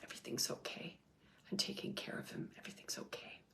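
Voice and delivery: barely audible, soothing whisper; hushed reassurance. Retold or imitated. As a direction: whisper it as a gentle comfort. Grandmother's comfort relayed after a sigh.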